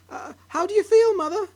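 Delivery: high, loud anxious question. Acted. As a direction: ask in real worry. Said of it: "very anxiously".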